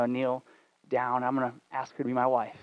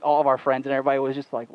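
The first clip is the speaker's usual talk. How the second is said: ordinary talk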